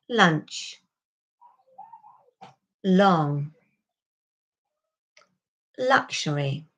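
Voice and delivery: "Lunch", "long", "luxury" with the L resonating further back; model for repeating. Shown as a demonstration. Teacher models words for learners to repeat. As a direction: say each word slowly for copying. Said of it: "So you can repeat after me".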